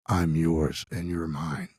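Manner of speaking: low, very slow menacing voice; demon voice. Retold or imitated. Demon speaks behind a frightened child, low slow voice. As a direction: draw it out low like a demon claiming someone. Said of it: "this voice goes".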